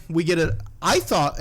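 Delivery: usual speaking voice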